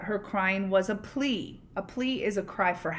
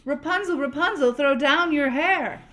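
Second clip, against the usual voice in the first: higher, louder call up to the tower; prince calling up; 6 semitones higher, 6 dB louder than usual. Acted.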